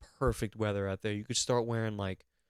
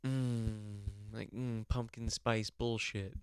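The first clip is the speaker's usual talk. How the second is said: contented murmur; 2 semitones lower, 10 dB quieter, slower than usual. Acted. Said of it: "and just go".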